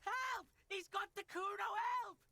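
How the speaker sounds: high, hammed-up cries for help; shouting for help. Kuno shouting to the windows for help, hammed-up. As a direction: yell up at the windows, faking panic. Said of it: "the child shouts at the windows".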